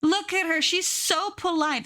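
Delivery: high, slow cooing over a polite snail. Real, not acted.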